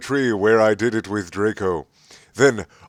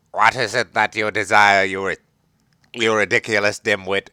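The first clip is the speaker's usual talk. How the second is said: lightly voiced cold sneer; cold Snape. A narrator's reading. Snape sneering at Ebony in class, lightly cold. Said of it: "Snape demeaned angrily in his cold voice".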